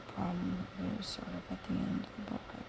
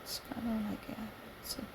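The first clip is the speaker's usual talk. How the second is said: whisper; 3 semitones higher, 7 dB quieter, faster than usual. Real, not acted. Quiet aside whisper.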